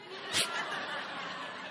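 breathy burst of real laughter; soft unvoiced laugh; open laugh. Real, not acted. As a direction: laugh out at the punchline. Laughing at own story.

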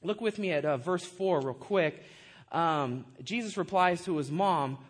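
ordinary talk

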